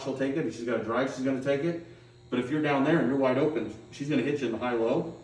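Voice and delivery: ordinary talk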